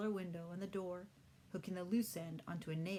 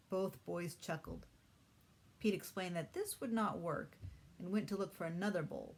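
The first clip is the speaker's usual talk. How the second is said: usual speaking voice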